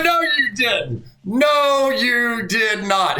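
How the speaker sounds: high, drawn-out disbelief; incredulous. Retold or imitated. Friend reacting to the excuse given to the coach, incredulous. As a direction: stretch every word in hammed-up astonishment.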